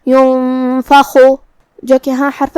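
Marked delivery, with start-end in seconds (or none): 0.1-1.2 ينفخ with hidden nasal نون before ف; ينفخ, نون concealed with ghunna, slow; ikhfa demo. Shown as a demonstration. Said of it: "نون ساکن کو چھپاتے ہوئے اور غنہ کے ساتھ".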